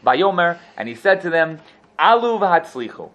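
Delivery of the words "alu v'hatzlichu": higher and louder dismissive blessing; sarcastic. Acted. Prophet answering the king, sarcastic loud blessing. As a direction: toss off the blessing with scorn.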